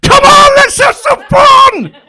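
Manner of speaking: very high, loud, slow cheesy yell; holiday rep screaming at guests; hyper holiday rep. Retold or imitated. Coked-up animator whooping at sunbathers to join in.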